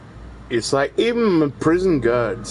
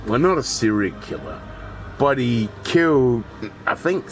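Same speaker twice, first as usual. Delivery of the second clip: usual speaking voice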